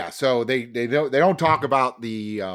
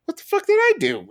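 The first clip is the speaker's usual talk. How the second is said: high panicked squeal, a bit louder; alarmed shout; 4 dB louder than usual. Retold or imitated. Juror panicking at the staring prosecutor, high squeal. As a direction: shriek it in mock alarm. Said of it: "I'm like".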